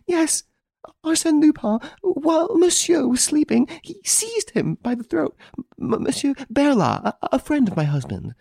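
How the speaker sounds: a lady crying to the commissary; sobbing out what happened; weeping. A narrator's reading.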